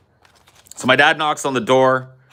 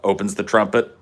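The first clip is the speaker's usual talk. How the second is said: plain reading voice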